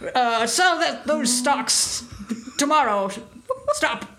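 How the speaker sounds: put-on telegram-reading old-money businessman; old-timey rich man voice. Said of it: "That's my old-timey rich man voice".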